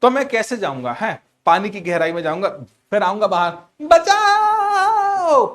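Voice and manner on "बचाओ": loud, high, long-drawn shout; drowning cry for help. Acted. Teacher acts himself drowning, surfacing to yell, long high shout. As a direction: shout it out, stretching the last vowel. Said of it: "चिल्लाता है".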